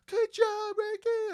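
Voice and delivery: high-pitched, loud sideline holler; coach's yell. Retold or imitated. Coach cheering a player's diving save, high excited yell. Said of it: "I could hear my coach going".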